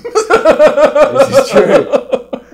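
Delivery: high, slow laughing admission; real laughter. Real, not acted. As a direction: laugh as you confirm it. Laughs confirming odd childhood.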